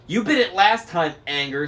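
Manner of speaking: high, loud squabbling crone; bickering hag. A narrator's reading. Driver bickering with her sister. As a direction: snap back like a bickering old witch. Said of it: "said the driver".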